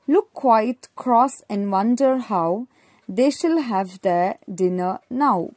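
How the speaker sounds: put-on slow, clear poem reading for kids; reading to children. A narrator's reading. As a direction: read the rhyme slowly and plainly for young learners. Reads poem aloud to children.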